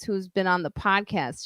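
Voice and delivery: ordinary talk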